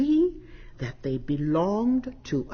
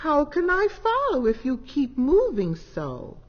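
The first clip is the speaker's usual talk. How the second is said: high, louder plea; pleading kitten; 7 semitones higher, 4 dB louder than usual. A narrator's reading. Lost cat begging the fireflies, high and plaintive. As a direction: call after the darting lights. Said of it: "said Snoopy Cat".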